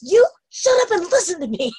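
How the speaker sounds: high-pitched scolding yell; mock outburst, pitched well up; re-enacted yell. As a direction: act out yelling at yourself. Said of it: "and I'm doing this".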